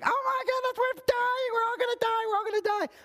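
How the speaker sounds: hammed-up screech of a man losing his head; panicked yelling. The useless guy in a burning building, mock panicked high yell. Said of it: "starts yelling".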